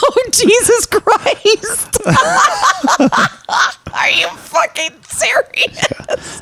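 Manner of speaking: high, slow, laughing disbelief; cracking up in shock. Real, not acted. Shocked by screen name.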